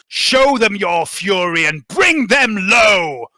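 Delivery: loud, high, slow battle rally; bellowed commanding speech. Acted. Game master as officer rallying Arbites against heretics, bellowed. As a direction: bellow it like a commander rousing troops. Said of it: "he bellows".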